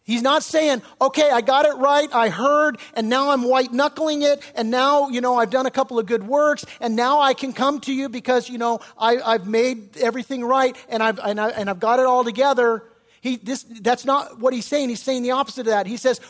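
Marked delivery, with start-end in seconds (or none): 1.0-12.8 louder, higher mock self-assurance; self-satisfied. Preacher voicing a man who fixed himself, mock self-assured. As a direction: voice someone loudly pleased with their own efforts.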